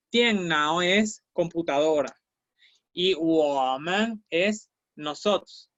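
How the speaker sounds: ordinary talk, nothing marked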